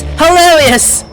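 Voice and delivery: usual speaking voice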